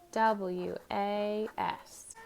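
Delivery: slow, W A S letter by letter; spelling letter by letter. Shown as a demonstration. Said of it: "spell it with me".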